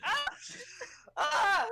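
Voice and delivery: put-on sudden high scream of a startled kid; high squeal. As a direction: shriek in fright. Kid screams as she lands on him.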